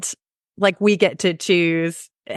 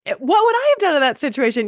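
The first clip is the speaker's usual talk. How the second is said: curious passerby; 11 semitones higher than usual. Retold or imitated. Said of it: "to be like".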